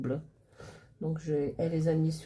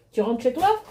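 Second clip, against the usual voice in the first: grandma voice; 7 semitones higher, 9 dB louder than usual. Real, not acted. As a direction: coo at a little granddaughter.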